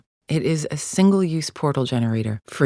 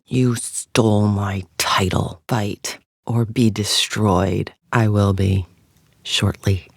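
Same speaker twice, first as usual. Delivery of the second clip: low, very slow menace; deep, deliberate warning; menacing threat; 5 semitones lower, slower than usual. Acted. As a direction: threaten a rival, drawing out each word. Menacing threat to a rival fighter.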